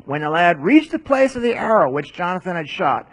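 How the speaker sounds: normal talking voice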